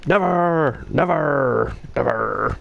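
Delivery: mock preacher-politician thundering in a Belfast accent, stepping down in pitch; Ian Paisley. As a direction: imitate a defiant Ulster reverend, dragging out each final r. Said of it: "Like the Reverend even Ian Paisley".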